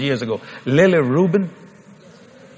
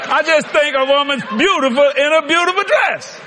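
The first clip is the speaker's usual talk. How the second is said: high, sustained declaration over the crowd; high-pitched, emphatic proclaiming; emphatic insistence; 10 semitones higher than usual. Real, not acted.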